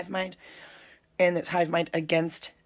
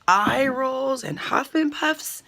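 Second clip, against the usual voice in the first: slow and loud, each word savored; mocking emphasis; 6 semitones higher, 4 dB louder, much slower than usual. Real, not acted. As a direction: drag it out in mock disgust. Mocking his angry reaction.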